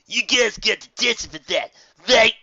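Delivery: loud, high bully voice; smug troublemaker; rowdy colt. Acted. Scuffle voice.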